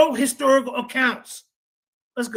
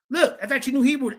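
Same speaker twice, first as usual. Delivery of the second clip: usual speaking voice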